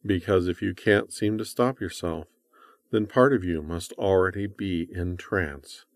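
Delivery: ordinary talk, nothing marked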